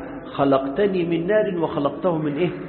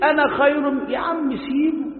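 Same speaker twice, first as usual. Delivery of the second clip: a hint of a haughty Iblis, higher; voicing Iblis; 8 semitones higher than usual. Preacher voicing Iblis, arrogant and dismissive. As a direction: lightly act out the proud devil brushing it off. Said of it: "مين قال أنا؟".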